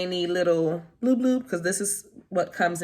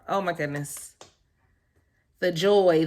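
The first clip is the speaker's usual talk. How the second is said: normal talking voice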